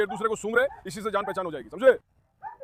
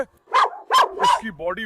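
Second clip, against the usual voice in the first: high, very drawn-out wow; amazed admiration; 3 dB louder, much slower than usual. Real, not acted. Praising the dog's chest, high and drawn out. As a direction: stretch it in awe.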